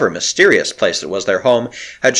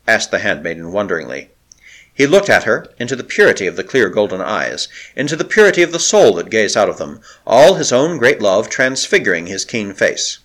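ordinary delivery

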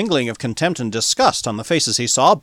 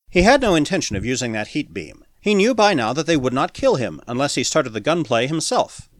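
ordinary delivery

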